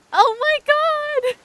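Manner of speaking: very high, slow ecstatic scream; shrieking jackpot winner. Real, not acted. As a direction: scream it like a fangirl. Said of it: "I'm literally gonna cry".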